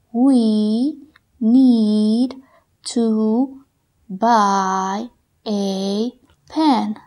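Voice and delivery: each word separated and slowed; very slow dictation. Shown as a demonstration. Teacher dictating slowly for students to write. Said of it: "Trata de escribirlos de acuerdo como los escuches".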